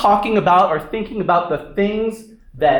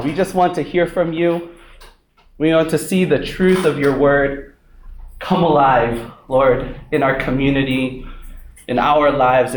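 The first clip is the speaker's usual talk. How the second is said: opening prayer; 5 semitones lower than usual. Acted. Pastor leads opening prayer.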